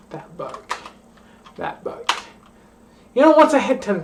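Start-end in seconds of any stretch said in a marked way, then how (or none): 0.1-0.8 That book whispered under the breath; whispered catchphrase. Shown as a demonstration. Drunk vlogger repeating her catchphrase, whispering. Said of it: "I've been saying". 1.6-2.1 whispered catchphrase. Shown as a demonstration. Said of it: "I've been saying".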